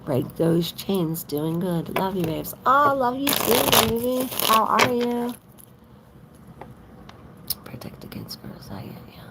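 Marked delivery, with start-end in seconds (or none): 2.7-5.3 gushing reply. Real, not acted. Replying to a viewer's love. 7.7-9.2 whispered aside. Real, not acted. Murmured aside reading comment.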